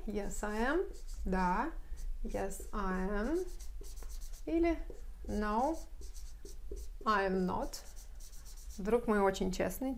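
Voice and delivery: usual speaking voice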